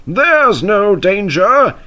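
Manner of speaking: slow, high, cocky boast. A narrator's reading. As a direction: brag that there's no danger. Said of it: "he boasted".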